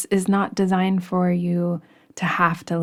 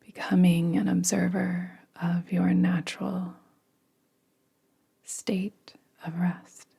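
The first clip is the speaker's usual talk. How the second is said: guided meditation. Acted. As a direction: ease them gently into rest. Leads a yoga nidra meditation.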